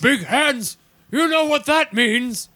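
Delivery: booming royal proclamation voice; mock royal decree. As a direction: announce it like a king. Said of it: "and he says".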